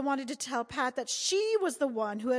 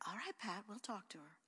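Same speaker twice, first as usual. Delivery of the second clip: soothing mother; 14 dB quieter than usual. A narrator's reading.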